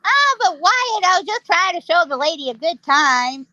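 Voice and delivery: high whiny protest, imitating a cowboy; high nasal pitch. Ike excusing himself to Wyatt, high and nasal. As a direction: start high up in the sinuses, protesting. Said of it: "high, high pitch nasally".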